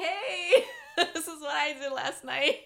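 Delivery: high, laughing show-off; laughing brag. Retold or imitated. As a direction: toss it off with a laugh. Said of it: "I was like".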